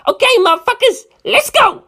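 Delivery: high, loud mocking yell; jeering shout at his friend; yelling Narancia. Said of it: "Narancia yelled".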